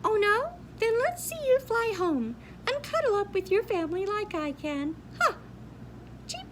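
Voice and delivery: high, fast bird voice jeering; high chirpy taunt. Acted. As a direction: gloat like a cocky bird.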